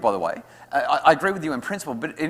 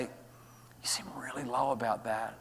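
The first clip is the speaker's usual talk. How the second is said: gentle empathy; 10 dB quieter, slower than usual. Shown as a demonstration. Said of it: "I'll say".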